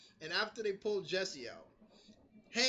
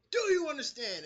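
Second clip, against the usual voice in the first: louder and higher, pressing the question; mock Marie repeating herself louder; insistent repeat; 7 semitones higher, 6 dB louder than usual. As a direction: demand it again with force. Marie demanding, repeats harder.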